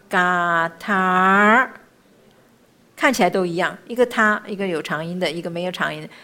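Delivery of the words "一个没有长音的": ordinary delivery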